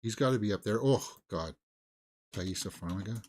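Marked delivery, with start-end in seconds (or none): none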